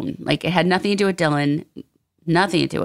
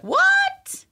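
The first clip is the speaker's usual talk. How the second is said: surprised. Pretend. Imagined confused viewer reaction.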